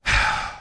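audible weary exhale; sigh. A narrator's reading. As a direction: let out a sigh before speaking. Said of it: "Cross sighed".

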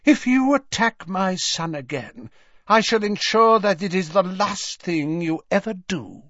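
high, imperious warning; cold, protective mother's menace; cold threat. A narrator's reading. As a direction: threaten with icy control in a high woman's voice. Mother threatens her son's attackers.

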